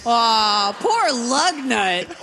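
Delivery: high, drawn-out cooing sympathy; mock pity. Real, not acted. Co-host pitying a baby robin with a silly name, cooing pity. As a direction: coo it slowly, way up high.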